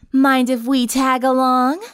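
high, slow cheerful request. A narrator's reading. Vampire clinging to the van door, cheerful. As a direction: draw it out sweetly, rising at the end. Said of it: "Lyra said cheerfully".

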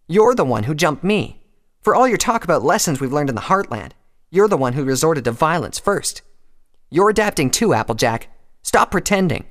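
loud, high-pitched indignation; angry accusation. A narrator's reading. Rainbow accusing Applejack of starting the fight, angry.

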